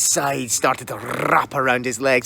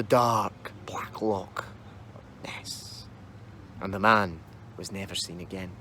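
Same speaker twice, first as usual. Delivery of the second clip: slow, hushed whisper; 7 semitones lower, 10 dB quieter than usual. A narrator's reading. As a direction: breathe it out darkly. Narrator's hushed climax.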